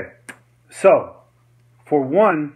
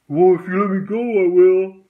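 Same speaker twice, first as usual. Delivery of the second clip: loud, higher put-on protest; louder, raised mock voice of the man being held; imitated grabbed man; 6 semitones higher, 8 dB louder than usual. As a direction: mimic someone pleading to be let go.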